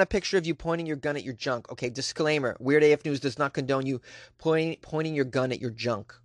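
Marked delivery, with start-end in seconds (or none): none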